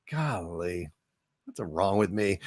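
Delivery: soft and low, half to himself; self-scolding mutter. Real, not acted. Host quietly scolding himself for his slip, soft mutter. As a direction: mutter it softly, shaking your head at yourself.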